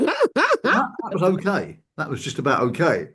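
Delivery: starts very high, sinks to a flat okay; grudging verdict. Retold or imitated. The therapist's grudging verdict on himself, mock reluctance. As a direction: concede it grudgingly. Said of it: "And you're like".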